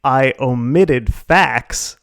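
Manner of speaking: slow and deliberate. Acted. Defending hiding PVP.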